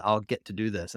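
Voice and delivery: ordinary delivery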